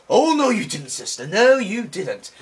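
high, loud, drawn-out reproach; hammed-up diva-style telling-off; sassy mock outrage. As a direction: scold with exaggerated sass.

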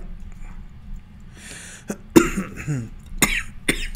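hard dry coughing fit. Real, not acted. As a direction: cough dryly, unable to stop. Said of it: "Sorry, ich muss husten".

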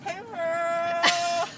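put-on high, loud sassy "hey girl"; sassy greeting. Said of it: "I could just be like".

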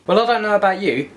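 ordinary talk

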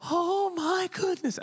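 put-on high, slow, gushing old lady. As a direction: draw it out like a fretful old woman. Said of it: "She's like".

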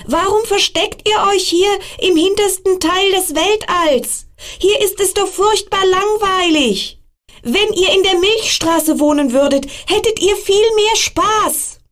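put-on high, eager child voice; little star child. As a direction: coax the comets to come along. Star child urges the comets.

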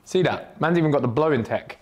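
ordinary delivery

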